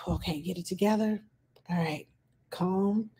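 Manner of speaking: soft murmur to herself, getting it together; calming self-talk. Shown as a demonstration. A caregiver steadying herself before answering the toddler, softly. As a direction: talk yourself down under your breath. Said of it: "stop and breathe and go".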